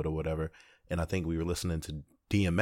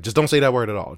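firm warning; 4 semitones higher, 10 dB louder, faster than usual. Retold or imitated. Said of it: "I was like".

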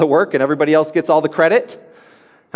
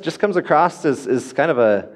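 normal talking voice

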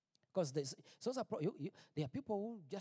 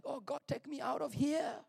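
raised, higher pleading cry to God; desperate plea; 7 semitones higher than usual. Retold or imitated. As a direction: beg God to get you out, voice lifted. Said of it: "I'm like".

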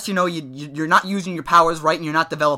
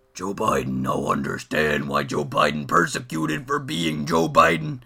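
much lower, slow, dim-witted brute voice; lumbering monster Joe Biden; 10 semitones lower, slower than usual. Retold or imitated. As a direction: speak like a lumbering hulk who barely gets words out. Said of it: "like, hush whispered, he'd be like".

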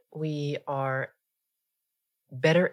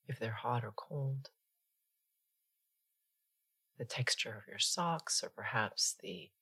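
guided meditation; 3 dB quieter than usual. Acted. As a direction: ease them gently into stillness, unhurried.

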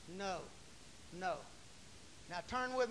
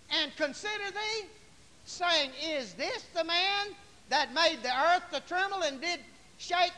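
high, loud, slow scripture declaiming; forceful preaching; 8 semitones higher, 9 dB louder than usual. Real, not acted. Preacher mocking the fallen devil to his congregation, forceful and loud. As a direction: ring out each phrase to the whole church.

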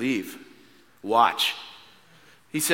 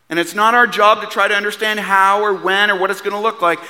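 rising preaching; 8 semitones higher, 6 dB louder than usual. Acted. Preacher building to climax.

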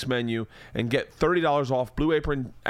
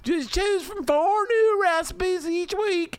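hammed-up Southern accent; slower than usual. Host mocks wife reading meal plans in a Southern accent. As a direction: stretch each word in a Southern drawl. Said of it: "I wish I hadn't done it in that accent".

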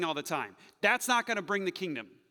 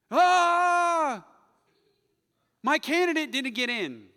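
hammed-up high, loud wail; mock a fretting voter; mock-anguished cry over a lost election; mock panic; 8 semitones higher, 10 dB louder, slower than usual. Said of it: "We fret and we get worried".

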